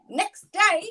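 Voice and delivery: ordinary talk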